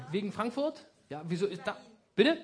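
ordinary talk, nothing marked

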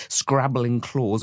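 plain reading voice

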